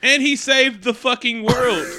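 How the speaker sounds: high, loud, drawn-out outburst; incredulous shout. Acted. Co-host capping the rant about Iron Man, shouting incredulously. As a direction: belt it out in mock outrage.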